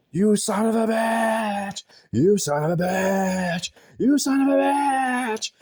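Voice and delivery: high mocking shriek, slow and drawn out; imitated shouting. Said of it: "he was just shouting and shouting".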